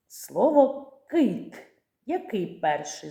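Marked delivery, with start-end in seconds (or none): 0.1-1.6 put-on drawn-out, higher word for children; slow word demo. Shown as a demonstration. Teacher to children, slowly spelling out a word. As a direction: stretch the word out slowly and clearly. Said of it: "Давайте поділимо слова".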